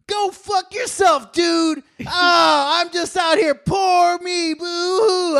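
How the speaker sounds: very high, slow hammed-up shout; mock whiny rant. Friend mimics the woman's self-pitying rant, very high. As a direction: whine and curse in a squeaky put-on voice. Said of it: "they're still yammering".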